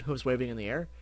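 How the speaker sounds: ordinary delivery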